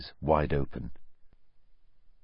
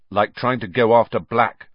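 pointed, louder retort; sharp; 4 semitones higher, 8 dB louder than usual. A narrator's reading. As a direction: cut him off sharply. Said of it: "said Ron sharply".

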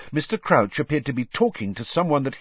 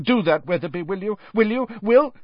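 higher and louder with each repeat; pressing question spiralling up; rising demand; 10 semitones higher, 5 dB louder than usual. A narrator's reading. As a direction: demand it again and again, climbing. Crouch's demand breaking up.

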